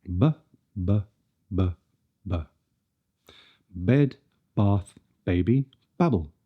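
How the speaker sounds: put-on "Bed, bath, baby, babble" with gentle b; soft voiced b. Shown as a demonstration. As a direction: voice behind the lips, little force. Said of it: "Not too much force".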